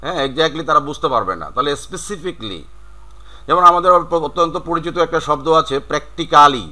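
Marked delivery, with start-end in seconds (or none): 1.9-2.6 "specifically" as spesi-FIK-lee; "specifically" ending in "fik-li"; correct "specifically". Shown as a demonstration. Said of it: "সঠিক উচ্চারণটা হচ্ছে". 5.9-6.7 "practically" as prek-ti-KAA-li; every written syllable of "practically" sounded; spelling-based "practically". Shown as a demonstration. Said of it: "বানান যদি করি তাহলে".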